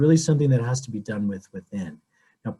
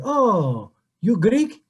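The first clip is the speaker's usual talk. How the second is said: high, louder imitated Greek accent; 9 semitones higher, 4 dB louder, much slower than usual. Greek woman questioning a Japanese parishioner, high imitated Greek accent. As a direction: mimic a Greek woman's accent, pitched well up. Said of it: "with her beautiful Greek accent".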